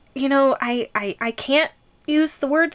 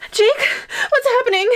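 panicked cry; 10 semitones higher, faster than usual. Acted. Trapped girlfriend cries out to Jake in panic. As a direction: scream out in panic from inside the jar.

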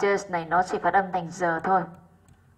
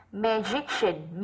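"Magician" drawn out slowly, softer, stressed on "gi"; stress on JIC; 5 dB quieter, much slower than usual. Shown as a demonstration. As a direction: say "Magician" very slowly, leaning on the middle syllable. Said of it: "Magician rơi vào jic vì nó có âm shin".

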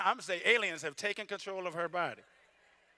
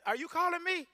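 God teasing; 9 semitones higher, 10 dB louder than usual. Pretend. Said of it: "he like".

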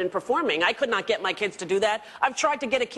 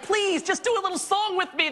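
pleading; 10 semitones higher than usual. Retold or imitated. Pleading with kids to sing.

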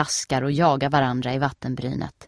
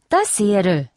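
put-on louder, higher happy girl; glad; 5 semitones higher, 6 dB louder than usual. A narrator's reading. Nelly tells Steffi happily, louder and higher. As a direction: say it cheerfully, pleased. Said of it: "säger Nelly glatt".

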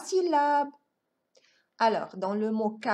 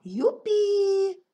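high, loud "yippee" with a held last vowel; triumphant drawn-out hooray; gleeful cheer; 9 semitones higher, 5 dB louder than usual. Pretend. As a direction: cheer like a delighted pupil. Cheer at learning to read.

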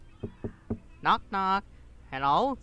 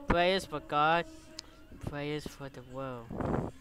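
reading aloud; 4 semitones lower, 4 dB quieter than usual. Acted. Streamer reads game lines aloud.

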